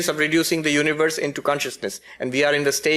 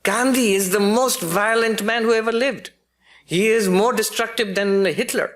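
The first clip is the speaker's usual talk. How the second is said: imitating an Eastern European philosopher, higher pitched; raised-pitch retelling with a hint of Eastern European accent; heavy Eastern European accent; 8 semitones higher than usual. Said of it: "he's this heavy, uh, Eastern European accent".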